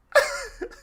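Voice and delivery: short, loud, high laugh; real high-pitched burst of laughter. Real, not acted.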